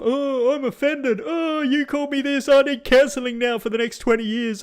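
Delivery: high, whiny mock outrage; mocking offended whiner. Mocking Americans who take offence at words, high and whiny. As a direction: ham up being offended.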